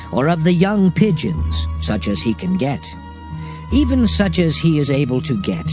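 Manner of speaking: ordinary talk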